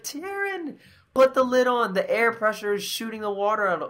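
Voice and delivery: very high mimicked call, easing down into instruction; friend's urgent order. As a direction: imitate an exasperated friend giving urgent advice.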